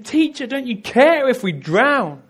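high, loud frightened plea; desperate shout over the wind; panicked cry. Acted. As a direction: cry out in terror over the storm. Disciples crying out in storm.

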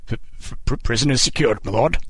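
a nervous stammer, eager to please; stammering servility. A narrator's reading. Warden to the king, stammering and servile. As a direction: stumble over the first word, cowed. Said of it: "reduce this man to stammering servility".

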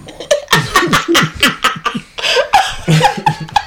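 real high-pitched laughing fit; long, breathy burst of laughter; laughing hard. Real, not acted. Podcaster cracking up at a Voldemort joke, laughing hard.

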